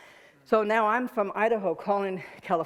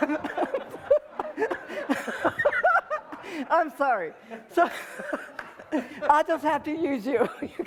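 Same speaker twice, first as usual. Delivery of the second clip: real fit of high-pitched laughing; losing it, words squeezed between laughs; helpless laughter; 8 semitones higher than usual. Real, not acted. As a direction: crack up and try to talk through it. Cracks up on stage.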